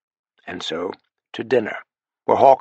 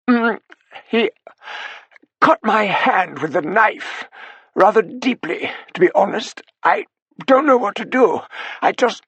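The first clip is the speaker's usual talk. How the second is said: put-on a frightened old butler, pitched high and strained; the butler reporting a menacing intruder, voice tight with fear; high, tight, hesitant servant voice; strained, muted butler; 10 semitones higher than usual. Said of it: "in such a strained and muted voice".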